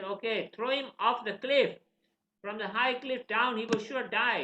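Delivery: high, loud acted order; forceful command. King ordering the boy thrown down, forceful.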